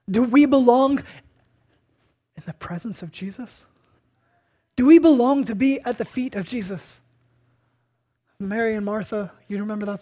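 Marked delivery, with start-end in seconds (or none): 0.1-7.0 louder challenge. Real, not acted. Preacher's rising challenge.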